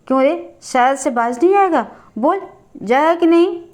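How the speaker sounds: mildly threatening, rising demand; stern questioning. A narrator's reading. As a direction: press the boy for an answer. Father sternly questions defiant boy. (सख़्ती से डपटकर पूछना)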